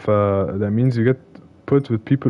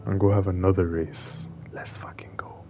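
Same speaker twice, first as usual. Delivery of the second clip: whisper; 5 semitones lower, 11 dB quieter than usual. Real, not acted. Winding down quietly.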